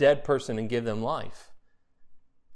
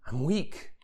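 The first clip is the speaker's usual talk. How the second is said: quiet confession; 6 dB quieter than usual. Pretend. Quoting Paul's confession.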